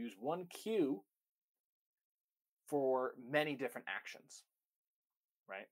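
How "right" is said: ordinary talk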